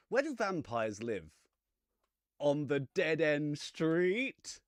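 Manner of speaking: drawn-out punchline rising high and loud; setup, then big slow punchline; joke delivery. Acted. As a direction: sell the corny joke, stretch out the punchline. Character tells vampire a joke.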